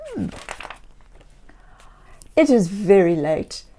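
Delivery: usual speaking voice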